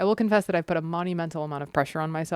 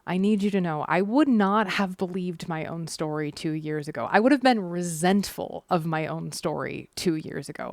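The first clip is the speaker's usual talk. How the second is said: usual speaking voice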